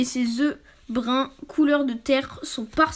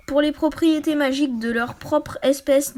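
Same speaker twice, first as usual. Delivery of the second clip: ordinary delivery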